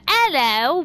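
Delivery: high, loud posh "hello"; mock British accent. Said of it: "I don't wanna do the".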